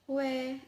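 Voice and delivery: teacher pronouncing "hui"; Pinyin hui. Shown as a demonstration. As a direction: model "hui" clearly. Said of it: "the words like".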